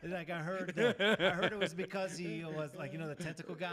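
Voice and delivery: put-on laughing loud pirate accent; Caribbean accent through laughter. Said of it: "Can you say it in, like, the Caribbean accent?".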